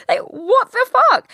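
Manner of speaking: loud mock-horrified what the fuck; incredulous outburst. Real, not acted. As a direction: exclaim in disbelief at yourself. Exasperated at her own small talk.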